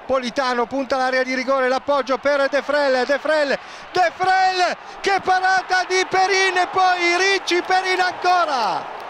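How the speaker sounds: rising, urgent save call; excited live commentary. Real, not acted. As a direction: climb in pitch as the shot comes.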